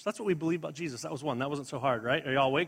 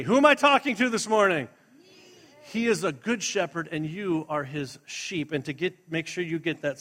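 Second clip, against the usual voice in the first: normal talking voice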